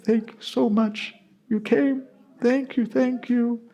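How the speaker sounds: high, slow and soft, choked with tears; overwhelmed gratitude, close to crying; thanking through tears, voice up high; tearful thanks. Acted. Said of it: "[name] really began to cry".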